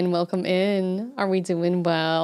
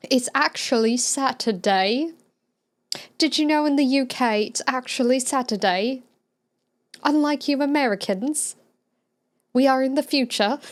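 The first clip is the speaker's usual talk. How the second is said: higher-pitched put-on British voice; mock British accent; 5 semitones higher than usual. Streamer voicing a UK viewer to Americans, mock British accent. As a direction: playfully boast in a British accent.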